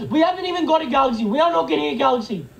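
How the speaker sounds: normal talking voice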